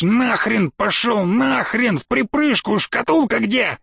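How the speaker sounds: high-pitched, frantic cursing; angry roar. Acted. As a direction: curse the boss off, drawing out the first words. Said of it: "рыкнул Евгений Петрович".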